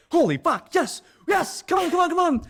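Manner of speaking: high, loud, jubilant shouting; excited shout. Real, not acted. Seeing the taxi pull up, she shouts with joy. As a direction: yell for joy as rescue arrives.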